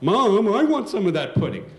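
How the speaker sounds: put-on high, loud kid shouting for pudding; brother yelling. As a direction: yell out like a jealous little brother. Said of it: "my brother yells out".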